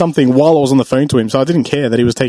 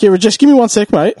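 put-on Aussie bogan accent; 5 semitones higher than usual. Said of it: "most Aussie bogan accent".

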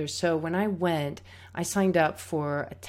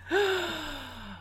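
shocked gasp; 10 semitones higher, 3 dB quieter than usual. Retold or imitated. Reaction to teacher's remark.

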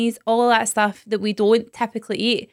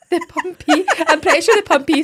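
higher, laughing out the words; laughing through words; 7 semitones higher, 3 dB louder than usual. Real, not acted. Joking about pumped meat, laughing through the words. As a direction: say it while laughing.

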